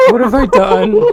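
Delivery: high, loud laughing wail; laughing mock despair. Reacting to the joke, laughing mock despair. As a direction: howl it through laughter.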